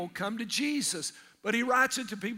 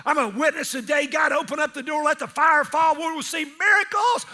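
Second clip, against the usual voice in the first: loud, excited shout, higher; fired-up mission trip; 4 semitones higher, 6 dB louder than usual. Retold or imitated. Preacher acting a fired-up believer, excited shout. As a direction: hype yourself up for a big day.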